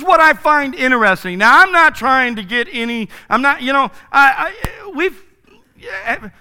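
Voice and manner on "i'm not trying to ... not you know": normal talking voice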